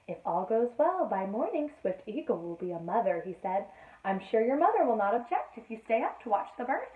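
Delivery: lively read-aloud storytelling voice; storybook reading. A narrator's reading. Mother reading a bedtime story to Sophie, lively. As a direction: read the tale to a small child.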